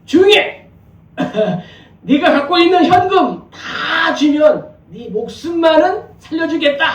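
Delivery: high, loud robber's threat; threatening robber. Acted. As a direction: demand the cash like an intruding robber. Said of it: "도둑이 들어와서 주인을 협박했습니다".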